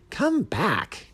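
high-pitched call after someone. A narrator's reading. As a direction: call them back from a distance. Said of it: "the bat called".

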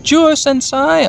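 high, slow imitated call; Sora calling out. Imitating Sora calling Juo, high and drawn out. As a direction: stretch out the name in a high voice. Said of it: "Didn't she just say".

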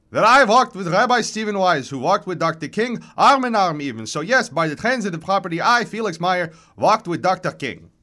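high, loud, self-important declaration; vigorous chirping old voice, proudly insistent; reedy old man. A narrator's reading. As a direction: boast like an accented old man proving a point. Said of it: "His voice is reedy but vigorous".

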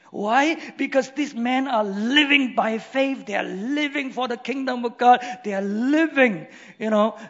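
fervent preacher voice; preaching at full force. Acted. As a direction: rouse the congregation.

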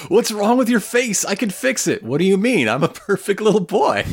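high, playful character voices. Acted. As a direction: voice the two characters lightly. Acting out two characters' lines.

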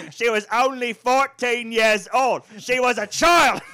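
high, slow, hammed-up character voice; mock outrage. Friend topping another's acted quote, slow mock outrage. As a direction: drag it out loudly in theatrical indignation.

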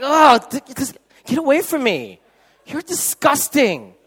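high, loud, slow scolding; mock disgust. Women scolding the cheering men, high mock disgust. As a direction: push him away in disgust. Girls rebuking guys.

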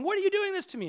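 high-pitched, outraged protest; mock raging bear. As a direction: rage like a wronged bear, hammed up.

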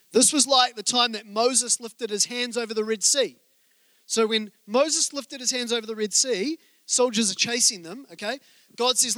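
normal talking voice

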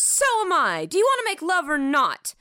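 lightly hammed lovers' quarrel; mock indignation. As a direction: act out an impatient retort. Acted girlfriend's retort in quarrel.

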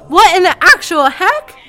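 loud high startled cry; shocked shout. Acted. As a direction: yell it in sudden shock. Shock seeing Furbies outside.

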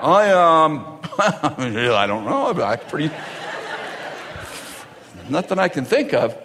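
tentative, trailing into nothing; unsure answer. Acted. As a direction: stall and fumble for an answer. Unsure person answering God.

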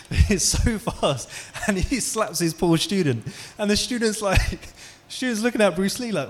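high, loud laughing speech; laughing while telling. Real, not acted. Preacher cracking up at the slap story, laughing. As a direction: laugh through the words.

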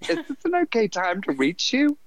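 a mock caller asking politely, pitched well up; imitating the recruiter. Recruiter on the phone asking the stoned narrator, polite and high. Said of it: "she kept going".

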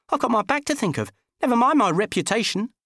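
high, huffy protesting man; indignant high man voice. Acted. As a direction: squeak out the indignation. Dickie's voice, protesting.

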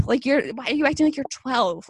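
ordinary talk, nothing marked

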